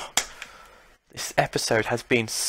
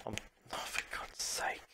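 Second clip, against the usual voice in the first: exasperated whisper; 3 semitones lower, 21 dB quieter than usual. Real, not acted. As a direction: breathe it out, defeated. Defeated after another loss.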